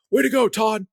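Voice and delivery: put-on high British-accented shout of encouragement; imitated British cheer. British bystander cheers on a runner, imitated accent. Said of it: "with a strong British accent".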